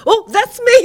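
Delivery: high, loud startled burst; sudden realization. Retold or imitated. Brother realizing the teacher means him, high and startled. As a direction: pipe up in surprised recognition.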